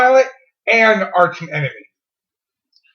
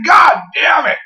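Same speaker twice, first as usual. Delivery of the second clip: frustrated shout; 7 semitones higher, slower than usual. Real, not acted. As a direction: curse it out in frustration. Losing the quiz question.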